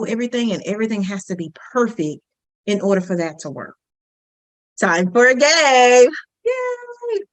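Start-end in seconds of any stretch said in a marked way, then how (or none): 4.8-6.1 loud, drawn-out host call; game announcement. Real, not acted. Coach kicks off a game for the class, loud and excited. 6.4-7.2 high, drawn-out little cheer. Real, not acted. Coach cheers the start of a class game, high cheer.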